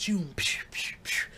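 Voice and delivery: soft mouth noises for flying chips; throwing sound effects. Retold or imitated. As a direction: whoosh like wood chips. Imitating thrown wood chips.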